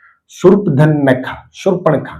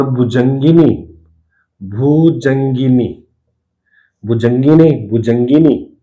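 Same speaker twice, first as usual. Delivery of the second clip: slow teaching; slower than usual. Shown as a demonstration. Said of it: "भुजंगिनी होता है".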